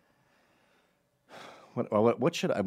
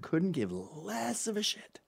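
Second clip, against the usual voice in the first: whispered aside; 9 semitones higher, 5 dB quieter, slower than usual. Real, not acted.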